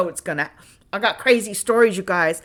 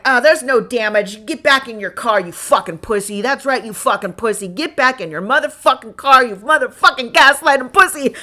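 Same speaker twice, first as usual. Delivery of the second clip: louder, higher mocking yell; retold loud taunting; 4 semitones higher, 7 dB louder than usual. Taunting the driver back into his car, loud mock rage. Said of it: "I was so mad at him".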